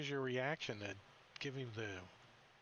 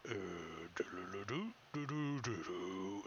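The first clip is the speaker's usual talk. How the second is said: dopey brontosaurus; 4 semitones lower, 4 dB quieter than usual. Acted. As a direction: hum like a dim giant dinosaur. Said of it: "the Brontosaurus is like".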